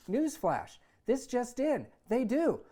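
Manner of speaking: mock newsflash. Son mocking, newscaster style.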